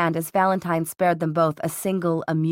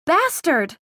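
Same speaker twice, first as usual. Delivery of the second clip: high-pitched outraged yell; sudden shout; 11 semitones higher, 4 dB louder than usual. A narrator's reading. Daughter cursing Valentine as she lunges, sudden shout. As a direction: burst out with the insult, pitch leaping. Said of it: "Isabel shouted suddenly".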